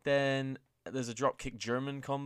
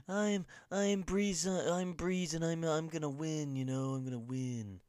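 hammed-up weird accent, higher and drawn out; Breeze; 6 semitones higher, 4 dB louder, slower than usual. Host mimics Breeze bragging in a weird accent. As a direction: stretch each word in a silly accent, sliding down. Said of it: "Breezango was doing those weird accents".

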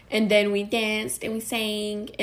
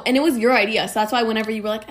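ordinary talk

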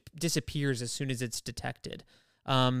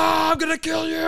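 much higher, louder shout; mock battle cry; 13 semitones higher, 7 dB louder than usual. Archangel charging at Apocalypse, hammed-up yell. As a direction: charge in yelling.

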